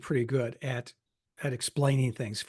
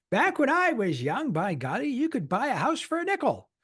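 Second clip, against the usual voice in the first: put-on very old man; 10 semitones higher than usual. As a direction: play a cranky old-timer reminiscing. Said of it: "Do I get the old man voice".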